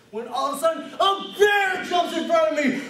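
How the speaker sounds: high, loud sudden alarm; alarmed storytelling. Acted. Android tells of bear jumping out, alarmed shout. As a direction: burst out with the shock.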